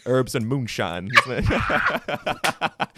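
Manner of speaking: slow, loud mock Deep South drawl; hammed-up Southern American, h dropped in "herbs"; Deep South American accent. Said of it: "if I do American ... Deep South".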